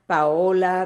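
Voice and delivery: ordinary delivery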